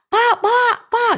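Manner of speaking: high, loud panicked knocking call. Acted. Neighbour panicking at the door, high frantic calling. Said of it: "dengan kepanikan".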